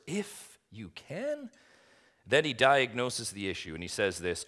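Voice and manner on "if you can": "If you can" very slow, leaping high on CAN; high, drawn-out, questioning "If you can"; incredulous emphasis. Acted. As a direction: draw out IF, then send CAN soaring in disbelief. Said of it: "That's that emphasis behind the words".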